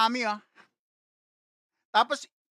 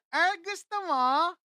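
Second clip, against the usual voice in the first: slow, higher playful tease in a put-on girl's voice; teasing offer; 5 semitones higher, slower than usual. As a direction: tempt him slowly, lightly mocking. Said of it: "Sabi sa akin". (mabagal na panunukso, boses batang babae)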